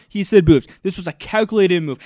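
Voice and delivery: ordinary talk, nothing marked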